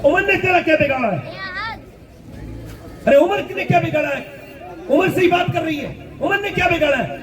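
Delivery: indignant question that grows into a shout; indignant rising demand. Acted. Umar asks why she weeps for him.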